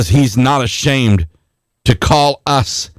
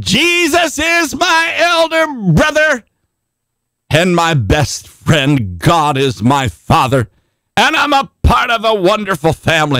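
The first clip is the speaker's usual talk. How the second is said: imitating a zealous declaration, high and drawn out; fired up; 7 semitones higher than usual. Preacher retelling a hotel manager's testimony, imitated as fired up. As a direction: proclaim it slowly, opening on a high sustained cry. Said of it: "he was fired up".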